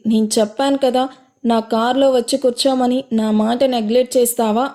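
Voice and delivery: plain reading voice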